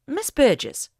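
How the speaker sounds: a hint of her strict mother's tone; stern voice. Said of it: "she put on her most stern voice".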